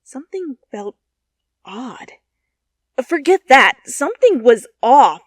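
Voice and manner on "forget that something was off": emphatic correction. A narrator's reading. Narrator correcting herself.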